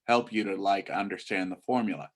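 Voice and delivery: put-on low-energy teen rambling full of filler likes; monotone teen run-on; teenager. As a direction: imitate a teenager chatting loosely about class. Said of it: "And this is a teenager".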